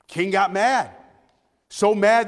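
usual speaking voice

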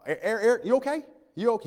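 high-pitched imitation of a Louisiana coworker; Louisiana accent. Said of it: "He's from Louisiana, so I need an interpreter".

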